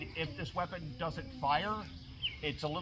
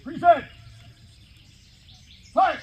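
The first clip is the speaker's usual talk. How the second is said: shouted command; 11 semitones higher, 11 dB louder than usual. Acted. Drill commands to firing line.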